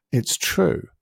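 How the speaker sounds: normal talking voice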